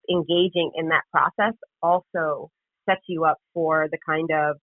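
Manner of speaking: ordinary delivery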